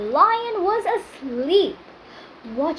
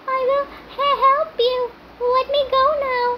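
squeaky pleading rat; 9 semitones higher than usual. Acted. Tiny rat begging the angry lion for mercy, high squeaky plea. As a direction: squeak out an apology like a frightened little rat.

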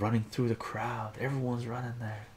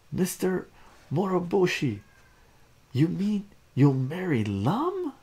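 high, loud, amazed question; excited questioning; 9 semitones higher, 7 dB louder than usual. A narrator's reading. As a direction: ask it as an astonished, excited crowd. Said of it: "Wow, everyone's excited".

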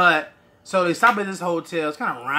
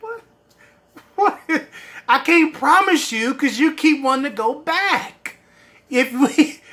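exasperated pleading; 5 semitones higher, 4 dB louder than usual. Retold or imitated. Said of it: "I'm like".

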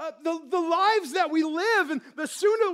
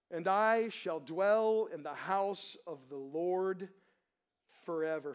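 scripture reading; 4 semitones lower than usual. Acted. Pastor reads the psalm to his congregation, reverent and measured. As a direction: read it as sacred text before the sermon.

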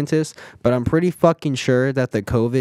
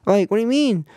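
exasperated rant; 12 semitones higher, 5 dB louder than usual. Real, not acted. Ranting about restaurant masks.

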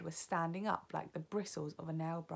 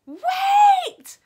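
piercing high witch's shriek; 21 dB louder than usual. A narrator's reading. Witch in the back row stopping everyone, shrieking. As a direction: shriek it to halt a whole crowd. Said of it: "shrieked one of the witches".

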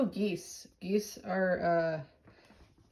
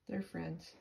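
ordinary delivery

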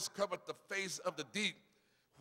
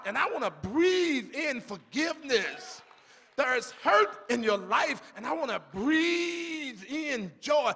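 fervent shouted sermon; preaching at full force; 3 semitones higher, 6 dB louder than usual. Acted. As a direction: hammer each line louder. Preaching climax.

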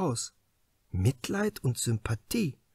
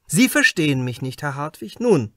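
a raised, higher, pressing voice, louder; probing inspector; 7 semitones higher, 7 dB louder than usual. Acted. Inspector Krause questions the suspect writer Hartwig, louder and pressing. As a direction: lean in hard, like an interrogator closing in.

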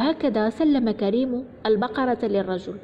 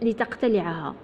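ordinary delivery

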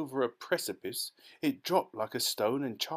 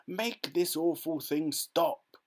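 commanding; 6 semitones higher, slower than usual. A narrator's reading. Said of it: "ordered Mister Teavee".

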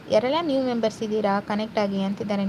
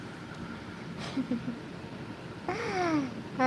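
high giggle; 5 semitones higher, 10 dB quieter than usual. Real, not acted. Streamer laughs at viewer chat.

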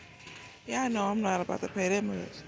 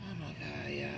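dismayed mutter; 4 semitones lower, 9 dB quieter than usual. Real, not acted. Dismayed at the honey price.

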